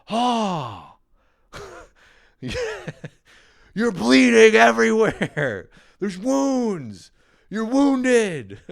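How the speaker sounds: high, loud, alarmed teasing voice; mock Caitlin. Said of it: "she's like".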